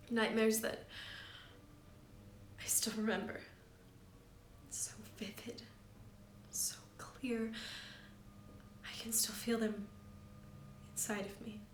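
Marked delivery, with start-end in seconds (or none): 4.7-11.6 hushed, haunted whisper. A narrator's reading. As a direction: whisper it like a secret. Haunted memory of nightmares.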